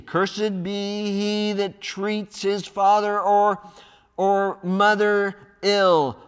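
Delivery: high, stretched-out reading in a loud voice. Acted. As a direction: declare the curse to a huge crowd. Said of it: "with a loud voice".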